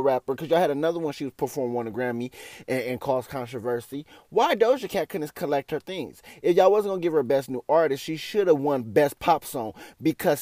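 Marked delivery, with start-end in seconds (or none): none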